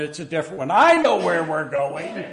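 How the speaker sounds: loud, fast Yiddish husband insisting; stubborn husband in light Yiddish accent. Pretend. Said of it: "would not listen".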